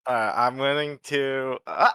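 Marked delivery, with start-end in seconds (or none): none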